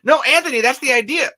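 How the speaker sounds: high, loud insistence; loud, high-pitched enthusiasm; excited insisting. Real, not acted. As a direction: burst in with the big idea. Excitedly explains plan.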